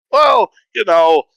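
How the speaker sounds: high, loud, drawn-out stalling; mock hedging. Broadcaster asked his price, retold as drawn-out hedging. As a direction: stretch it out high, imitating a reluctant haggler.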